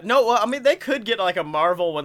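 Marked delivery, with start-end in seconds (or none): none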